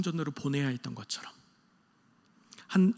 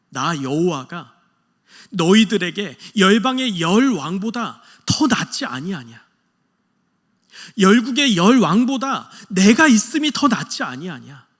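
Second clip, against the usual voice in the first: slow, swelling, louder plea, stress on 더; God's pleading cry; 2 semitones higher, 9 dB louder than usual. Retold or imitated. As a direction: plead with Israel, rising to a loud climax. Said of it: "하나님의 애잔한 마음". (이스라엘에게 호소하는 하나님의 애절하고 커지는 외침)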